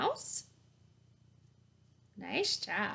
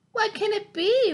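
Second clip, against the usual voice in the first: higher and louder curious question; eager wondering; 9 semitones higher, 8 dB louder than usual. A narrator's reading. Mouse spotting the bird, eagerly curious. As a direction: ask it with big eyes. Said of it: "wondered Mouse".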